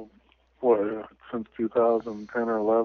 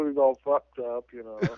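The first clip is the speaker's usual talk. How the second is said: ordinary talk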